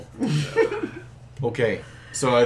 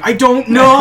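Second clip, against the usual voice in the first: high, louder shrug of a reply; a boy asked who the father is, pitched high; clueless kid voice; 10 semitones higher, 6 dB louder than usual. Pretend. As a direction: toss it off like a lost kid. Voicing the pregnant one.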